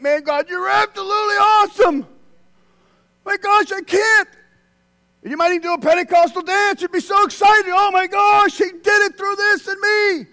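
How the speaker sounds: loud, high joyful cry; excited praise. Pretend. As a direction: shout it out like you're overjoyed. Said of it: "Wouldn't you think".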